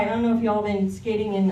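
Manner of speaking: ordinary delivery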